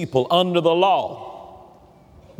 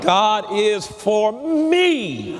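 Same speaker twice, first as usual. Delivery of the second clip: very slow, me stretched at the end; stress on me; 4 semitones higher, much slower than usual. Shown as a demonstration. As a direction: drag it out and land on me. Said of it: "Say it out loud".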